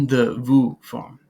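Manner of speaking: vous said slow and loud; vous stressed slowly. Shown as a demonstration. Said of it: "you'll use".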